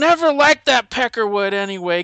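rant, then order the troops into action; hammed-up vulgar commander; cussing general. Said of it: "he cusses like nobody's business".

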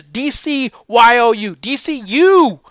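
Y O U spelled loud and high, final U drawn out; big high, stretched U at the end; emphatic pointing YOU. Shown as a demonstration. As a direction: announce D C U, booming on the U. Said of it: "They're pointing at you".

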